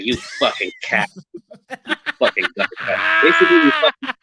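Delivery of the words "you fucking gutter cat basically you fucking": much higher, squealing mock insult; shrieking mock mom. Mocking the mom's insult, squealing high.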